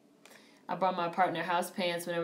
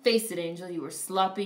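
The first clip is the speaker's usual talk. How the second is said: ordinary delivery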